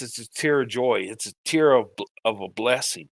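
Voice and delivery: real tears of joy breaking through; tearful joy. Real, not acted. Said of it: "any tear I have is not a tear of sadness".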